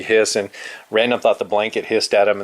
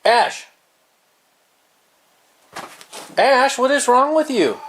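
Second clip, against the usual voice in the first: high, loud, slow exasperated scold; scolding yell; 12 semitones higher, 4 dB louder, slower than usual. Real, not acted. Owner yelling at Ash for the cheap shot, high and loud. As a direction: demand an answer from a naughty cat. Scolding cat attacking another.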